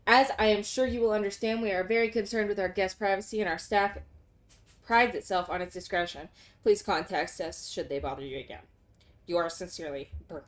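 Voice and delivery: low, even formal reading; formal letter read. Acted. Player reading the hotel manager's letter, low formal read. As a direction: read it like a polite hotel notice.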